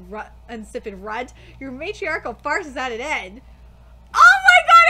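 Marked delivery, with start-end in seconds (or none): 4.1-4.9 high, loud stunned cry; shocked shriek. Real, not acted. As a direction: gasp in disbelief. Shocked reaction.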